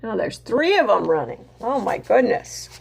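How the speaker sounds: plain reading voice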